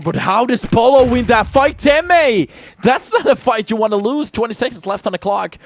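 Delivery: loud, high caster hype over a tense fight; excited. Real, not acted. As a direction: pitch jumps way up, loud play-by-play yell. Caster hypes a clutch fight.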